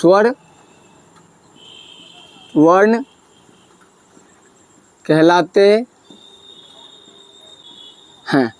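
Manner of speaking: slow dictation, long pauses between words; slow dictating teacher; dictation pace. Shown as a demonstration. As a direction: spell it out slowly for students to write. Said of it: "तो लिखिए".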